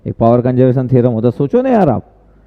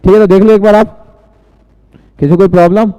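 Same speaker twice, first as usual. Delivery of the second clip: louder, raised voice checking if everyone follows; calling out to class; 5 semitones higher, 6 dB louder than usual. Real, not acted. Teacher loudly asks his online class if all is clear. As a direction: call out so the whole class hears.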